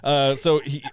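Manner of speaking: ordinary talk, nothing marked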